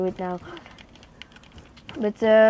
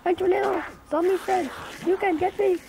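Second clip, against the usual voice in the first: high mock taunt; 9 semitones higher than usual. Taunting the witch.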